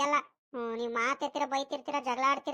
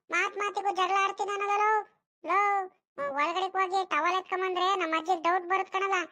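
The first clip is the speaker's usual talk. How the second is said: put-on slightly raised boyish voice, bickering; exasperated boy Sunila; 4 semitones higher than usual. Sunila refuses to fetch the towel past grandma, exasperated. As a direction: protest in an exasperated whine.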